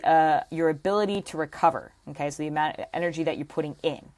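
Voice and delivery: normal talking voice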